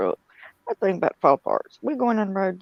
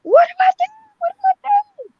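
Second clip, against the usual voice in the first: high, loud, frantic wail close to crying; panicked shriek; 10 dB louder than usual. Retold or imitated. Carol panics as the wheel comes off.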